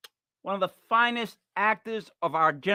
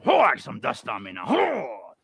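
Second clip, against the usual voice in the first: groaning old mummy, half-played; lightly voiced mummy grumbling; slower than usual. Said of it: "Imagine a mummy that goes".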